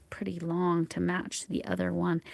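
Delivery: usual speaking voice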